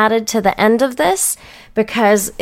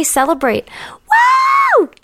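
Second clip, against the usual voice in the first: excited cheer; slower than usual. Real, not acted. Cheering sign-off.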